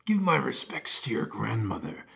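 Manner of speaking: low sly wolf whisper. Acted. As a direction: breathe it like a scheming wolf. Wolf's sly farewell.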